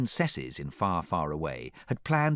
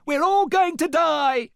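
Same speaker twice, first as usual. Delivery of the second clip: high, loud, drawn-out shriek; panicked; 8 dB louder than usual. A narrator's reading. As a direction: wail in sheer panic. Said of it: "The princesses panicked".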